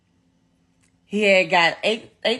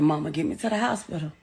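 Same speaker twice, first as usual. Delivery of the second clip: low, soft, quick quote; retold unpanicked plea; 6 semitones lower, 6 dB quieter, faster than usual. Wounded son asking his mother for help, retold without panic. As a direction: quote him softly, without any panic. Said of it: "He never panicked".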